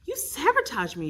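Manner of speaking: loud cry of outrage. Acted. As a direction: shout it in shock. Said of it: "you cry".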